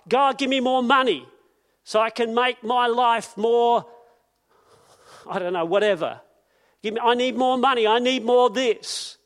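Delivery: mocking the greedy prayer, loud and pushy; greedy demand. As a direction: whine for money like a greedy child.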